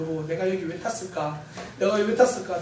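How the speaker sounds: low, subdued husband's lament; quiet regret. Retold or imitated. Husband to wife on the roller coaster, quiet regret. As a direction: re-enact a husband's rueful murmur.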